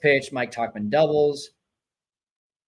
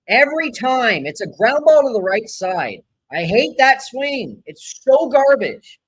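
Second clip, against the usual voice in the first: fired up; 6 semitones higher, 7 dB louder than usual. Real, not acted. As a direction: vent at the hitter's swing. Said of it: "Sorry, I'm fired up".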